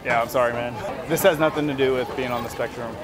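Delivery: usual speaking voice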